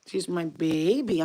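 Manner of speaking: ordinary talk, nothing marked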